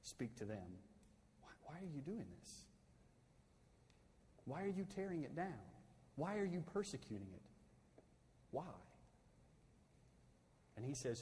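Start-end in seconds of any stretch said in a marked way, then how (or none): 1.4-8.7 much softer, gently searching question; voice of Jesus. Retold or imitated. Pastor voicing Jesus calling to Saul, soft and pleading. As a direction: ask it quietly, as a pleading voice from above. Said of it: "needs to hear that voice. Speak to them".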